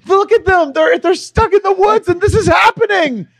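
very high, loud, frantic yelling; panicked shout. Retold or imitated. Girlfriend terrified by the movie, yelling at him. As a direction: shriek it like it's really happening. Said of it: "And she's like".

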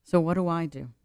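normal talking voice

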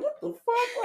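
very high, laughing bewilderment; retold disbelief. Friend grabbed by homeboy's dad, high mock bewilderment. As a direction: pitch it way up, baffled and amused. Retelling his shock at being grabbed.

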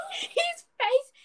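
high, breaking up with laughter; laughing. Real, not acted. Sister recalling his face during the presentation, laughing. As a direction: laugh through the words.

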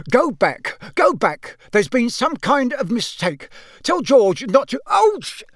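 high, urgent cries with yelps of pain; flustered alarm. Acted. Father squashed in a fireplace to his sons, flustered. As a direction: squawk orders in a fluster.

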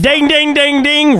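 high, sung dinging; mock winner's bell. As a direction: imitate a game-show bell to tease. Mock bell mocking co-host.

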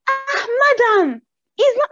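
high, drawn-out pleading imitation; acted protest. Teachers pleading with an angry mother, acted protest. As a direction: imitate flustered adults objecting, slow and high.

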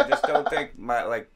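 usual speaking voice

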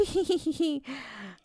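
a taunting little laugh; cheeky laugh. A narrator's reading. Anansi laughs at the pumpkin man, taunting chuckle. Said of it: "And Nancy laughed".

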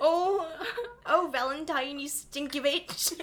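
put-on a laughing, half-broken Irish accent; giggly mock Irish accent. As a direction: put on a jokey Irish brogue. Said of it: "He speaks with an Irish accent".

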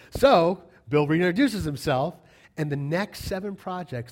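normal talking voice

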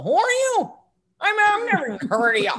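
high, incredulous challenge, then rushed; hammed-up disbelief on the phone; mock indignant stranger. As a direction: imitate a baffled theater guy brushing you off. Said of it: "guy in Kansas go".

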